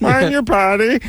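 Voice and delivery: high, nasal cartoon alien voice; Marvin the Martian voice. Shown as a demonstration. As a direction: do the Bugs Bunny alien. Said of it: "alien from the, uh, from the Bugs Bunny cartoon".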